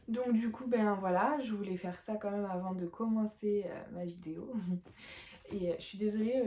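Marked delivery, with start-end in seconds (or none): 4.6-5.5 soft laugh over nothing; short, real, tired giggle. Real, not acted. Mother apologising to viewers for laughing over nothing, soft giggle. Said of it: "je rigole tout le temps pour rien".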